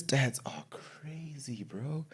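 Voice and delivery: slow, drawn-out whisper of amazement; awed whisper. Real, not acted. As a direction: whisper it slowly, as if in awe. Marvels at stats, dropping to whisper.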